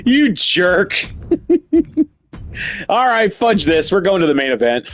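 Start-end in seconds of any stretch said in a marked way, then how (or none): none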